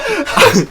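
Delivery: high, loud shriek of laughter; laughing burst. Real, not acted. Host cracks up at a friend's silly place name, laughing burst. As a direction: crack up loudly.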